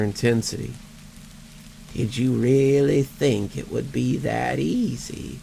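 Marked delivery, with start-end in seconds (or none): 1.9-5.4 slow, wheezing undead voice; a dry, rasping dead man; wheezing ghost. Acted. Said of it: "he wheezed".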